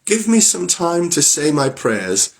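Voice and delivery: high, slow, pleading wife; wife in tears begging Bluebeard for time; tearful plea. Acted. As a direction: ask for time to pray, close to tears. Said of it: "her eyes all bathed in tears".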